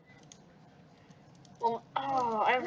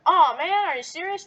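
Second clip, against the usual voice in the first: annoyed groan; 6 semitones higher, 5 dB louder than usual. Acted. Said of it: "Nick responded".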